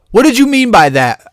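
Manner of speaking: sharp high indignant challenge; confrontational demand. Retold or imitated. She demands at the storyteller, indignant and high. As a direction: mimic her snapping the question.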